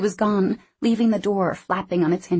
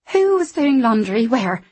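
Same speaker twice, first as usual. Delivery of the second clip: sharp, louder demanding question; 5 semitones higher, 6 dB louder than usual. Acted. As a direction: snap the question out. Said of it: "she asked sharply".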